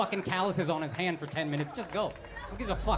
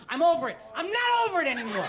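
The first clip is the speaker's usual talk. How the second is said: mock outburst; 6 semitones higher, 5 dB louder than usual. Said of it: "I was like".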